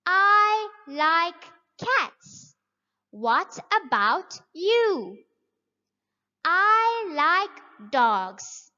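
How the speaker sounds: slow, clear, every word spelled out; slow model reading. Shown as a demonstration. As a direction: read it slowly for young learners. Teacher models dialogue for class.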